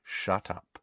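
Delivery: softly spoken order; quiet. A narrator's reading. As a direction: say it quietly, contained. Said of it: "said Harry quietly".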